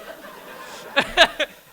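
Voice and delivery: loud, high, breathy laugh; real laughter at the scene; helpless laugh. Real, not acted. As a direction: let the laugh burst out. Laughing at own story.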